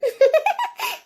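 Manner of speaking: a short, bashful rising giggle; shy giggle. Acted. Wife giggles shyly when her husband calls her pretty. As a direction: giggle at his flattery. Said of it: "என் பொண்டாட்டி சிரிச்சா எவ்வளவு அழகா இருக்கா".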